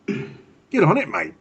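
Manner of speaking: high, drawn-out mock Aussie; Aussie accent. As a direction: put on a playful Australian accent. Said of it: "you gotta sign off in the Aussie accent".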